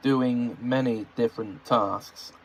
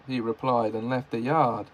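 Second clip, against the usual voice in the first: plain reading voice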